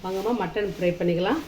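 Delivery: ordinary talk, nothing marked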